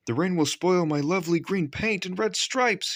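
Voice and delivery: high, precious complaint; fussy engine. A narrator's reading. Henry refusing to leave the tunnel, high and fussy. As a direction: fuss over his paint. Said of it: "he said".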